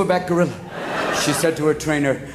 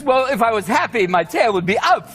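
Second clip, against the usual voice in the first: loose Walken cadence, higher and louder; mock Walken logic; Christopher Walken impression; 7 semitones higher, 4 dB louder than usual. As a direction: explain the tail like Walken would. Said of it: "people went, Why, Chris".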